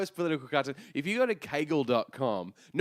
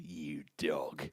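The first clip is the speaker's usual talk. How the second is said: much lower, quiet gruff You dog; Uncle Greg voicemail; 10 semitones lower, 8 dB quieter than usual. Retold or imitated. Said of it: "just go".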